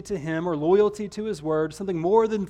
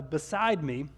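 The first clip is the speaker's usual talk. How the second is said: normal talking voice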